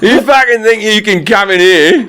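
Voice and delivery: high-pitched, hammed-up Aussie villain; Australian Bond villain. Bond villain threatening from his lair, mock rant. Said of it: "there's never been a Bond villain".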